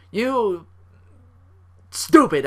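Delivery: drawn-out mock insult with a punched finish; imitated anger, holding back. Podcaster imitating a wrestler avoiding the B word, mildly angry. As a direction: pause before the insult as if swallowing a curse. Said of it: "dancing around that, that B word".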